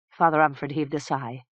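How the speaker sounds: ordinary delivery, nothing marked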